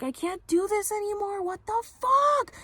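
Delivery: very high-pitched mock scream, stretched at the end; squealing, overwhelmed outcry in imitation; retold yelling. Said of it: "She was, like, yelling".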